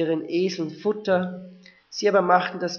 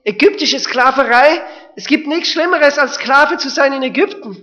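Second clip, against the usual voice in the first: loud, higher urgent proclamation; emphatic preaching; 7 semitones higher, 11 dB louder than usual. Real, not acted. Preacher stressing the horror of slavery, loud. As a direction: hammer home the horror.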